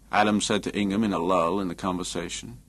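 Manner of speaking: plain reading voice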